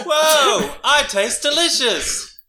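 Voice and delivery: high, loud delighted cry; gleeful soup discovering itself; excited whoa. Acted. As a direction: burst out thrilled at your own taste. Soup character exclaims in play.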